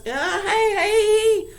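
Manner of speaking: high calls of hey; mock shout across the snow. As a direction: call out to a neighbor far off. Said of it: "shouting across".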